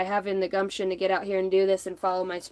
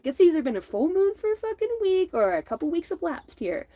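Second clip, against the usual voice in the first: higher, mock-exasperated rant; a touch of put-on exasperation; exasperated quote; 9 semitones higher than usual. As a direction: throw it up like you can't believe it. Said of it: "I'm like".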